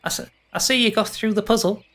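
quick, high faun voice; faun boy. Acted. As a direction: rattle it off like an eager faun. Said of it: "Yeah, he goes".

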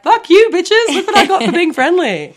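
high, loud mock taunt with a laugh; gloating, retold. Retelling getting into the car to friends, gloating. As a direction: crow it triumphantly at your friends.

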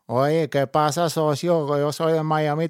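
a light Puerto Rican accent speeding up. Shown as a demonstration. Imitating a Puerto Rican met on holiday, light Puerto Rican accent. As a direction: rattle it off like a Puerto Rican from Miami. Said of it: "su acento".

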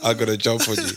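laughing playful threat. Real, not acted. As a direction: laugh while teasing.

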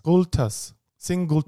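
affected Latin-accented pronunciation; put-on Latin accent. Shown as a demonstration. Co-host correcting his Latin term, put-on Latin accent. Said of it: "Why are you putting an accent on".

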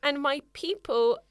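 put-on dramatic reading of an old problem letter; reading the letter aloud. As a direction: read it out like a lovesick Victorian girl. S1 reads the letter, continued.

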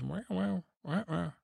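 high-pitched cat meows; Sir Pounce. Acted. Tommen relays his cat's interruption, high meowing. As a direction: meow the words in a high voice. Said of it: "And Sir Pounce says".

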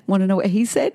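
usual speaking voice

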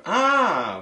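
ordinary talk

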